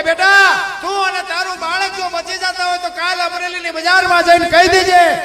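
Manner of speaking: loud, high fatherly command; commanding saint. Acted. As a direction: order her boldly to speak out. Saint commands girl firmly.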